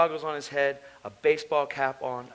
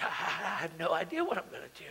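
tearful helplessness; 9 dB quieter than usual. Retold or imitated. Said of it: "And I cried".

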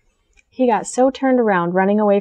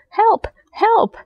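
much higher panicked cries; ants crying for help; 14 semitones higher than usual. Acted. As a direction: squeal for help as the rain pours. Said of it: "cried the ants".

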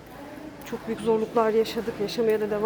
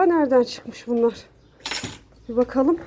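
ordinary talk

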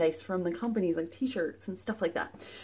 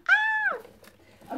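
short, very high delighted "ah!"; high-pitched excited yelp; excited squeal; 4 dB louder than usual. Real, not acted. Delight at box contents.

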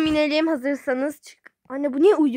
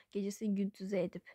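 whispered echo of gecesini gündüze edip; 3 semitones lower, 12 dB quieter than usual. Acted. Mother muttering her own words again, whispered. Repeats it under breath.

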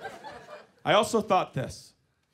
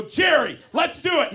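loud, high, hammed-up enthusiasm; excited rallying shout; 9 semitones higher, 6 dB louder than usual. Comic agreeing to the deal with Jerry, excited shout.

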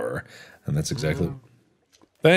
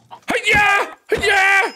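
high martial-arts cry; karate yell. Acted. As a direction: shout it like a karate chop. Attacking the cow.

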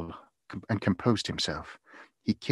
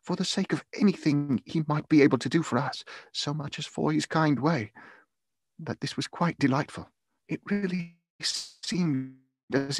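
higher-pitched, heartfelt enthusiasm; earnest cry; 6 semitones higher than usual. A narrator's reading. Grateful father praising a kind gentleman, earnestly crying out. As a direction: tell it eagerly in a raised pitch. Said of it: "cried Bob".

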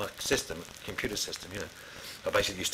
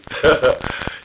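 loud laugh; 5 semitones higher, 11 dB louder than usual. Real, not acted. Laughing at failed coaching.

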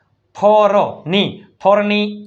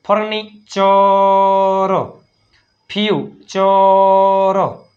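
Furniture and future stretched out word by word; slow model reading; 3 dB louder, slower than usual. Shown as a demonstration. Teacher models future slowly to students. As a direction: read each word slowly for the class. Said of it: "આવું બધી વંચાય?".

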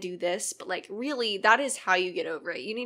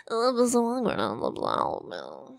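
purposefully stupid voice; slower than usual. Shown as a demonstration. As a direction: ham it up deliberately. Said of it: "doing it purposefully stupid".

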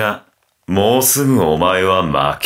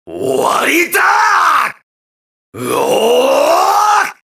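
high, drawn-out battle cry; finishing-blow yell; much slower than usual. Acted. Fighter finishing off an enemy, long high yell. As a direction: scream it long as you strike.

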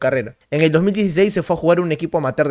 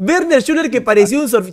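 announcer voice; 6 semitones higher than usual. Acted. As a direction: call the name like a stadium announcer. Repeat of announcer call. (voz de locutor de estadio)

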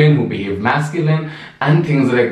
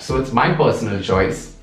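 ordinary talk

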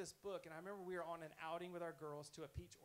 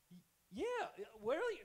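higher, louder feigned stammering surprise; playing dumb; 7 semitones higher, 9 dB louder, slower than usual. [name] hears her revelation and pretends surprise, playing dumb. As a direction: fake astonishment to let her tell it. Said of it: "I'm playing dumb now".

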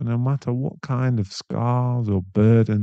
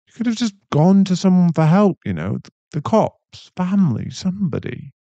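raised, higher, frustrated voice; exasperated plea; 8 semitones higher, 4 dB louder than usual. Acted. Boyfriend pleading with girlfriend why she stayed, frustrated. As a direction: push it out, upset on her behalf. Heated plea about abusive ex.

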